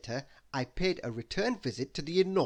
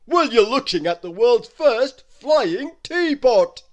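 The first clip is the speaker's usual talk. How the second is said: loud, slow booming giant, pitched high; a giant's big, slow welcome; Wizard Winterbottom welcoming; 8 semitones higher, 14 dB louder, slower than usual. Acted. Giant wizard welcoming Christmas into his castle, loud and slow.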